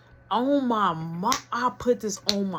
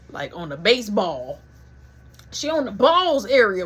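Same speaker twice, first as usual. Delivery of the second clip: ordinary delivery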